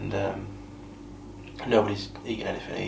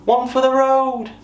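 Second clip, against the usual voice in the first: very high, loud, drawn-out call; imitated drunken holler; 8 dB louder than usual. Imitating a drunk guest who won't go home, hollering. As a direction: mimic her calling out for another drink.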